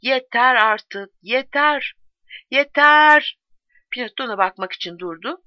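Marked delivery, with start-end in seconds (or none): 0.0-3.4 drawn-out shout, building higher and louder. A narrator's reading. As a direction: let each word stretch and climb to a yell. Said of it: "Olağanca sesiyle bağırıyordu".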